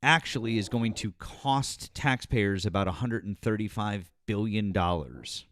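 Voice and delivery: ordinary talk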